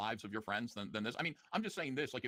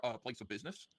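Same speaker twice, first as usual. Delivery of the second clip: ordinary talk, nothing marked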